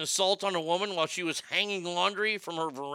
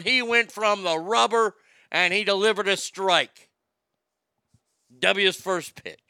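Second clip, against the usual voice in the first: announcer voice; 5 semitones higher, 9 dB louder than usual. Acted. Announces the second matchup.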